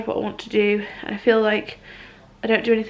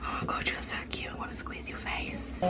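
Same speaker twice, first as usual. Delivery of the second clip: whispering; 18 dB quieter than usual. Real, not acted. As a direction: whisper it fondly over the cot. Cooing over the baby.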